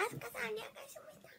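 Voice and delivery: hushed little acknowledgement; whisper. Real, not acted. Whispering okay to a viewer in the chat.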